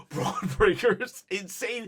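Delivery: ordinary talk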